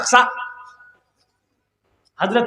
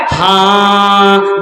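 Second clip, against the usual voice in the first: long-held low monotone answer; comically drawn-out obedient reply; simpleton son; 4 semitones lower, much slower than usual. Acted. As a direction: stretch the word out dumbly on one note. Dim son answers as told.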